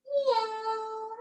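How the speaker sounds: a high, drawn-out meow; high cat meow. Shown as a demonstration. Teacher meowing high for the kids in cat pose. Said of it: "arch our back for cat".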